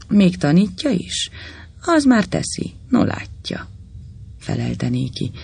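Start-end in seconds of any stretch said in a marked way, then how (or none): 0.1-3.7 scornful, rising to a whispered sneer; contempt. A narrator's reading. Judge scorning the fawning lawyer, contemptuous. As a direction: look down on him with every word. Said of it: "még nagyobb megvetéssel a hangjában".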